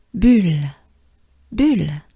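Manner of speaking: "bulle" with rounded lips, short French u. Shown as a demonstration. Teacher demoing French word bulle for learners. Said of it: "Together, ensemble".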